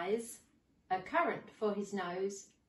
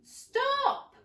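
high, loud shout; old man shouting after the gingerbread man; cry of stop; 4 dB louder than usual. Acted. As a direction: yell after the runaway. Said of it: "barked the dog".

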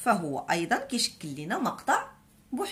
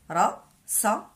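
slow syllable by syllable; much slower than usual. Shown as a demonstration.

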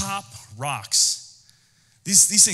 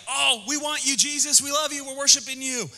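higher, gushing fake-devoted voice; mock adoring crowd; 9 semitones higher than usual. Preacher mocks the fickle crowd, high gushing adoration. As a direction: ham up a crowd fawning over Jesus. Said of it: "they were like".

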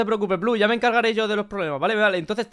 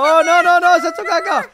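high, loud playful dismay; alarma fingida; 9 semitones higher, 7 dB louder than usual. Pretend. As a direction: exclaim in comic alarm. Alarma por la caca. (alarma exagerada, aguda y fuerte)

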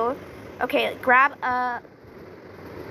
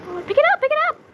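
frantic urging; 12 semitones higher, 4 dB louder, much faster than usual. Real, not acted. Gamer begging the item to lift, frantic and high. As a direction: pick it up, pick it up, in high frantic bursts.

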